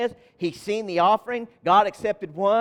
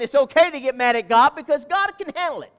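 rising preacher's emphasis, louder; preaching; 6 semitones higher, 5 dB louder than usual. Acted. Pastor assuring the church God can take their anger, preaching louder. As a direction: drive it home to the congregation. Preacher building to full force.

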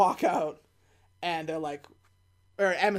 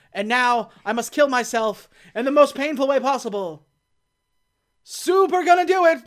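loud, hammed-up declaration, rising high at the end; melodramatic vow; 4 semitones higher, 7 dB louder than usual. Alien mourning his dead queen, melodramatic. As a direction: build to an overblown announcement.

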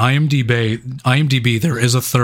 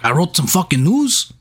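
mock Mexican accent; 5 semitones higher than usual. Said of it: "by doing this accent".

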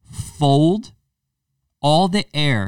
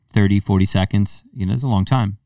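usual speaking voice

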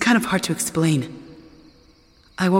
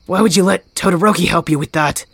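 usual speaking voice

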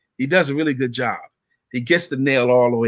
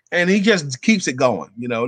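ordinary talk

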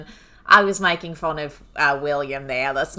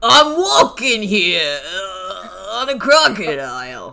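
slow, hammed-up Boston tough guy; imitating a New Yorker badly, slowly; mock Boston accent; slower than usual. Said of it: "It's not supposed to be good".

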